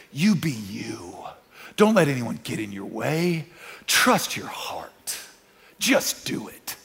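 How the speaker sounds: seductive whisper. Retold or imitated. Voicing culture's whispered slogans.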